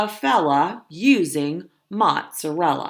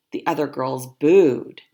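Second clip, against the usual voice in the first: ordinary delivery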